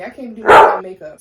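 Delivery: loud, exasperated complaint; irritated and loud. Real, not acted. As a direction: let the annoyance burst out loud. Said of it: "this is how irritated it makes me".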